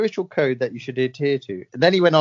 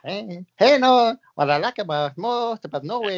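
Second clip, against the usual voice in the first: hammed-up Mexican-sounding accent, higher at first; Diego Maradona; 4 semitones higher than usual. Medium repeats the footballer clue, hammed-up Mexican-sounding accent. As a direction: lay the fake Mexican accent on thicker. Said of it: "it's gone more Mexican".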